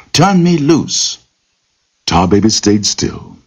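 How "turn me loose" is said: much higher, slow, pleading plea; frightened cry repeated. Acted. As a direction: cry it again, high and scared. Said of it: "the rabbit cried".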